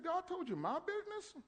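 high, incredulous question; mock disbelief. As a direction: sound amazed and a bit sarcastic. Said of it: "I said".

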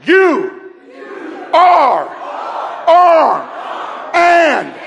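slow, high, shouted word by word; loud declaration. Shown as a demonstration. Preacher leading church to shout at neighbor, loud. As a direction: proclaim each word like a battle cry. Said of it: "I want you to tell 'em".

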